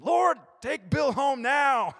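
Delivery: put-on high, loud, slow childish demand; mock vengeful prayer. Believer asking God to take Bill: high, loud, slow. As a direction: drag it out like a sulking kid wishing harm.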